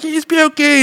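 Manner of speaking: a hammed-up driver girl freaking out, squealing; hysterical girl. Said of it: "And she's like".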